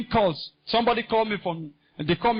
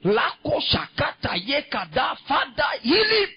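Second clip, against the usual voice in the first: re-enacted fervent prayer; 6 semitones higher than usual. Preacher retelling his prayer for a sick man, fervent shouted prayer. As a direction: call out the prayer, climbing high on the plea.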